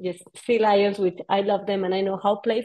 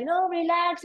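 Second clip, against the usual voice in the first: calming guide; 10 semitones higher than usual. Retold or imitated.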